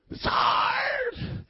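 very high, squealed fake cry; mock whine. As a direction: wail HARD as a hammed-up complaint. Mock complaint, later answered "not hard.

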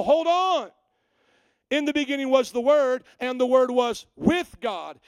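loud, high cry of hold on; emphatic hold on. Retold or imitated. Pastor halting the heretic's argument with a loud hold on. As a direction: slam on the brakes, loudly. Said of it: "put on their brakes and go".

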